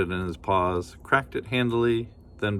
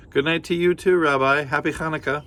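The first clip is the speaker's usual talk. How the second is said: much higher, louder goodbye call; fond farewell; 7 semitones higher, 4 dB louder than usual. Acted. Granny calling goodnight to the bear, high and loud. Grandmother voice.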